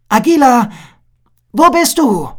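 high, loud call across the trees. A narrator's reading. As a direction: call for a friend you can't see. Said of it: "Nach ein paar Minuten rief er".

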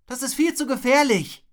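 loud, high warning; Zuruf. A narrator's reading. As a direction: call out that danger warning. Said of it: "rief Wilma ihm zu". (laut und hoch warnend rufen)

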